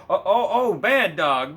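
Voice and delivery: high, loud mock outrage at the dog; fake scolding. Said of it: "he pretends to not know".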